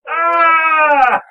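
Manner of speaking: loud, high scream of pain. Acted. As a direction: cry out as you hit the ground. Man lands badly off balcony.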